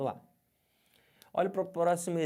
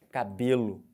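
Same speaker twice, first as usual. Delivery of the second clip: "cabelo" with BE hit louder, ca-BÊ-lo; forceful middle-syllable stress; 3 semitones lower, 5 dB louder, slower than usual. Shown as a demonstration. Teacher shows the true tonic syllable, forcefully stressing BE. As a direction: lean hard on "be" in "cabelo". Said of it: "fala essa palavra".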